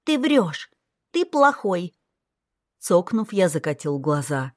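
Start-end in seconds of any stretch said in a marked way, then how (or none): 0.0-2.0 put-on high child voice, blaming; accusing little girl. A narrator's reading. Little girl calls her brother a liar, high and accusing. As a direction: point the finger like a hurt child.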